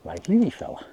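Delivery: delighted. Real, not acted. Fish jumps unexpectedly.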